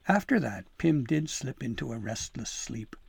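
plain reading voice

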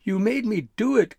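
high, loud accusing yell. A narrator's reading. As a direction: yell the blame back. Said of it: "Gristle yelled".

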